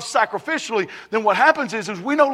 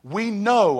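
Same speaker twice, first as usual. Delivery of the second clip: ordinary delivery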